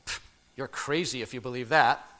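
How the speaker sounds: low defensive retort after a puff; defensive mumble. Retold or imitated. Richie answering back to Amy, mild defensive mumble. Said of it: "defensively mumbled".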